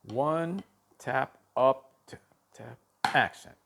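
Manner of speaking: spaced count with light taps before ACCENT; rhythmic stroke count. Shown as a demonstration.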